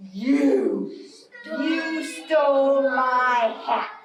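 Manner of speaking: slow, accusing bear voice; grumpy Bear. Acted. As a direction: drag out the accusation like an upset bear. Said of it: "and Bear says".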